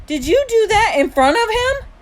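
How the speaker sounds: high, loud disbelieving question; shocked reproach. A narrator's reading. Mom confronts younger son, shocked reproach. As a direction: demand it, appalled.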